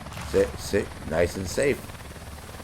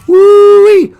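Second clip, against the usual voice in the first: high, loud whooping yell; wild whoop; 17 dB louder than usual. Pretend. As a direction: whoop like a game-show host. Ad pitch whoop.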